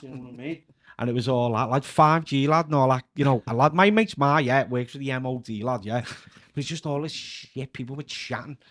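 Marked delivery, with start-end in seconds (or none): 1.0-6.0 louder Scouse lad on the phone ranting; a louder lad spouting five G nonsense down the phone; Kenny character. Retold or imitated. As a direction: play Kenny reeling off conspiracy theories to his mate. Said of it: "he's speaking to his mate on the phone".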